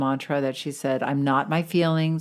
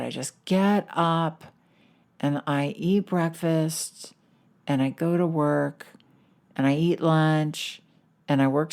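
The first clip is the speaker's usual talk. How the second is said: mopey and bored. Retold or imitated. Depressed Mary tells friends her days are dull, mopey. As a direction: drag through each step like nothing matters. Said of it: "sitting there very mopily".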